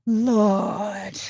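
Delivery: a crying, anguished Lord; pained Lord. Real, not acted. Lamenting the dragging, anguished. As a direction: let it break with dismay.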